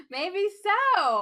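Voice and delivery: loud, high, through laughter; laughing. Real, not acted. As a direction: burst out with it while laughing hard. Laughing at co-host's war joke.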